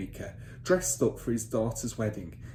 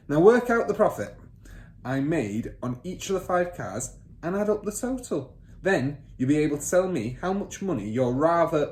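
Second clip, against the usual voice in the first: higher, louder command to write; bossy father; 6 semitones higher, 6 dB louder than usual. Acted. Father ordering son to take down figures, bossy. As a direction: snap the order at the boy.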